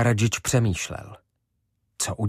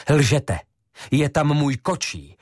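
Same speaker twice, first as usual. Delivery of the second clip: higher, louder outburst; angry accusation; 6 semitones higher, 4 dB louder than usual. A narrator's reading. Ivo accusing the old man of lying, angry and loud. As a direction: throw the accusation at him.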